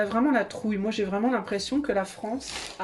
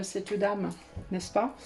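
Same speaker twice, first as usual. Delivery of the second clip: ordinary delivery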